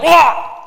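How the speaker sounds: high loud bleating "Wah!"; sheep wail. Retold or imitated. Preacher imitating a yanked sheep, high loud wail. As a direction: wail like a sheep hooked by the neck. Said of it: "they're like".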